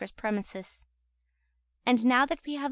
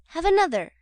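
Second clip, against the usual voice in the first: louder, higher eager offer; eager Charlotte; 7 semitones higher, 6 dB louder than usual. A narrator's reading. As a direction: urge him eagerly. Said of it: "said Charlotte".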